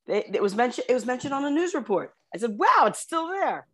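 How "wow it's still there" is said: high, drawn-out wow of surprise; retold amazement. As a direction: marvel at it slowly, pitched up. Reacting to hometown in news.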